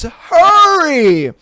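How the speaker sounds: high, urgent drawn-out call; booming yell. Acted. Unseen voice rushes man to the table, booming yell. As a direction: boom it out as an order. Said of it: "booms the voice".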